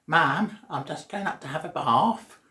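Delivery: a hint of a young son calling out; Archie to his mum. Son telling his mum he's off to the bath, lightly voiced. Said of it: "when he probably said".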